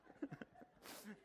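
breathy real laugh; soft chuckle. Real, not acted. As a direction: laugh at your own story. Laughs after the punchline.

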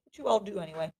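talking while chewing candy; words around a mouthful of candy; mouth full. Real, not acted. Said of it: "Sorry, I was eating M and M's".

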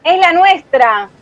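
correcting her sister mid-fight, emphatic; emphatic correction. Acted. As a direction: spell it out to her, slowly.

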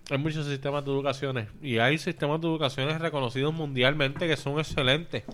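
talking with his mouth full; words round a bite of food. Real, not acted. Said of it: "hablando con la boca llena".

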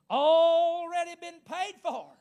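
slow, loud, high preacher's cry; triumphant proclamation. Real, not acted. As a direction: belt it out, stretching "Already". Climactic repeat of the declaration.

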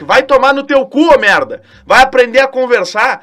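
loud, scolding rant; angry outburst. Real, not acted. Host scolds boys who use pickup lines, loud scolding. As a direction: tell them off hard.

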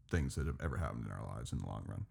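ordinary talk, nothing marked

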